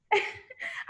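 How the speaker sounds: a high, teary half-laugh half-sob; tearful laugh. Real, not acted. Teacher to her class, laughing through tears. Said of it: "I'm choking up again".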